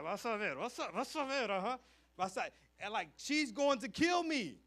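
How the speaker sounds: put-on a kid yelling that his mom will kill him; mock panicked yell. Retelling child insisting mom will kill him. (grito de pánico fingido)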